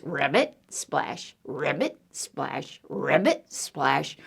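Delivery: low ribbits with soft splashes; low froggy croak and splash. Acted. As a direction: croak like a frog, hush each splash. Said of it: "Frog says".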